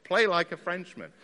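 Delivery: piano teacher shouting from the other room; imitated shouting. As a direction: call out an exasperated order, loud and high. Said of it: "he would come shouting in".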